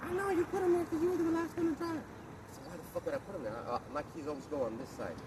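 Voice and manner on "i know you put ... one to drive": high squeaky whine of the wife blaming him; mocking wife voice. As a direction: squeak it like a caricature of a wife shifting the blame. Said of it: "guess what she said".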